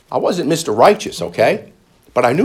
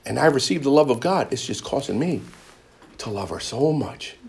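normal talking voice

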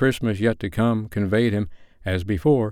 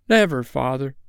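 lightly voiced higher plea of children; children's cry; 4 semitones higher than usual. A narrator's reading. Children promise their father they will remember, crying out lightly. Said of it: "cried they all".

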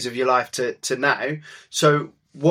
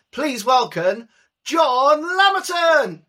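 slow, high, hammed-up West Country pirate voice; mock West Country accent; 10 semitones higher, slower than usual. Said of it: "in your finest West Country accent".

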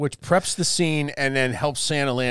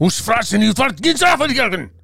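mock German gibberish, higher and louder; hammed-up German accent; fake German rant; 7 semitones higher, 4 dB louder than usual. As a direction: bark made-up German at someone off your lawn. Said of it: "I just made it up. But it sounded good".

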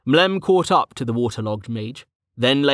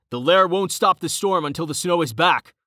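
higher, urgent call back; shouting over shoulder; 6 semitones higher than usual. A narrator's reading. Rain shouting back to Carten while towing Tahir. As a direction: throw it back while running. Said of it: "shouting over his shoulder".

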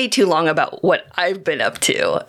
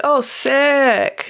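higher-pitched, drawn-out approval; impressed reaction; 8 semitones higher than usual. Acted. Replying to a friend's rad news, impressed.

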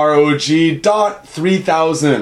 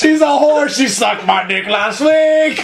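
Muppet heckler voice; 8 semitones higher, slower than usual. Retold or imitated. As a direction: cackle it like a balcony Muppet. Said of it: "They're like".

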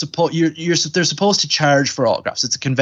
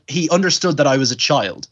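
normal talking voice

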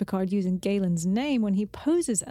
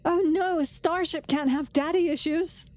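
higher, dismayed quote; acted quote; 6 semitones higher than usual. Co-host voices Tendi fretting about the ship. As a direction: voice Tendi's worry. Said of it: "Tendi says".

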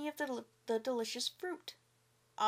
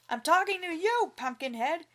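loud, high angry yell; 5 semitones higher, 6 dB louder than usual. A narrator's reading. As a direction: yell it in his face. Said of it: "yelled the farmer".

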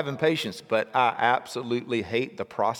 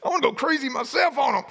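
losing it; 12 semitones higher than usual. Retold or imitated. Impatient parent losing it at wild kids. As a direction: act out going crazy on the kids. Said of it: "When I'm like".

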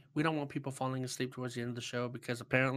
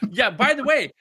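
excited; 9 semitones higher, 10 dB louder than usual. Real, not acted. Excited joke about the game.

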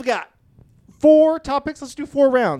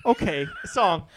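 ordinary talk, nothing marked

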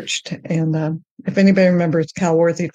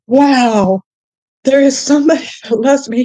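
high, loud and laughing with joy; overjoyed; 8 semitones higher, 5 dB louder than usual. Retold or imitated. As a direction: burst out with happy disbelief. Retelling joy at hearing God.

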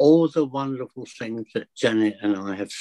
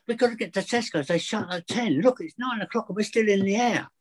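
mock urgency; 8 semitones higher than usual. Mock urgency about Tesco closing.

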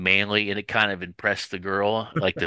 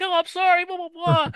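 very high, squeaky babbled apology; hammed-up high-pitched grovelling; panicked mock apology. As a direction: imitate a cowed man backing down. Retold scared Kim apologising to Neelix.

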